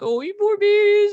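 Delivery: very high, loud mock baby-talk; exaggerated high-pitched coddling; cooing principal. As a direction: gush over a poor little child in mockery. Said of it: "It was, like, hugging him".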